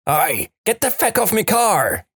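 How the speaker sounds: high-pitched Irish nun shrieking; screechy comic outburst without real volume; mock scream. Second nun screams at a vampire from the car, high mock shriek. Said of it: "leans out the window and screams".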